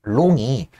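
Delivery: normal talking voice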